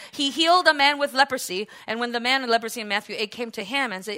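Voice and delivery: usual speaking voice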